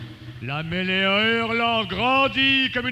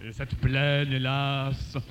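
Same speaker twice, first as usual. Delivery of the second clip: elegiac recital; 9 semitones lower than usual. Acted. Reciter mourning the vanished army, low and elegiac. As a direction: let the voice fall into solemn mourning. Declamation sinks to elegy.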